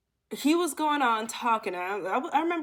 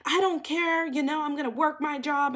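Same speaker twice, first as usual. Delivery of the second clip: high, defensive imitated outburst; mock freak-out; 6 semitones higher than usual. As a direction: mimic him flipping out. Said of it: "but freaks out".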